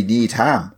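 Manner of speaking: NEEDHAM as need-ham, both parts full; two-word pronunciation. Shown as a demonstration. Friend explains the spacing, Needham as need-ham. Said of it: "space in between the ham and the need".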